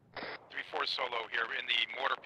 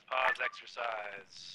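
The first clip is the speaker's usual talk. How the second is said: slowed and softer; slow, drawn-out call; 5 dB quieter, much slower than usual. Real, not acted. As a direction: stretch out pause, exercise, drawing each word long and soft. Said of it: "repeats the pause call".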